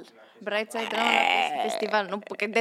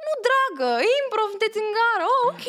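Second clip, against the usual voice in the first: mock stern lady; 10 semitones higher, 5 dB louder than usual. Retold stern lady reply.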